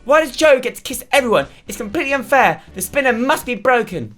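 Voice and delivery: very high, indignant whine; hammed-up jealous tantrum, pitched right up; Allegra. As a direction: protest in a put-on high girl's voice. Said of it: "says Allegra".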